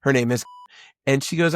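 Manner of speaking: normal talking voice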